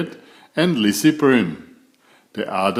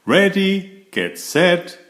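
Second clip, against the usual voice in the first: high, loud starting call; 6 semitones higher, 3 dB louder, slower than usual. A narrator's reading. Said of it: "called Preacher Brown".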